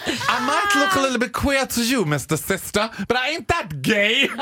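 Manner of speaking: high, slow, campy English comeback, laughing; sassy diva retort. Retold or imitated. Answering the clerk with a mock sassy diva line, laughing. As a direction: camp it up as an offended diva. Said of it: "Och jag bara".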